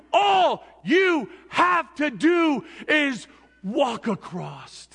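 rising fervent sermon shout; full-force preaching. Acted. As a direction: preach it out to the crowd.

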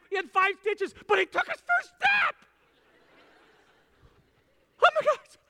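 very high, loud shrieking excitement; ecstatic bragging parent. Retold or imitated. Parent bragging to friends about baby's first step, shrieking. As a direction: gush and shriek over every milestone.

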